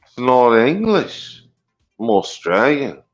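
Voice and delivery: bad Aussie accent that lands in England; shaky-English Australian accent. Guest insists he's Australian, in a bad put-on Aussie accent. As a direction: fake an Australian accent, badly. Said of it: "That's a pretty shitty fucking English accent".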